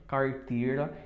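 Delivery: verdade and carteira with a curled R; strong caipira R. Shown as a demonstration. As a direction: lean into the country R. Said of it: "soa bem forte".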